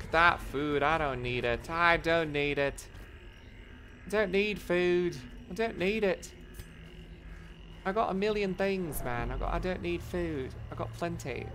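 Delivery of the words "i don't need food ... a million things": usual speaking voice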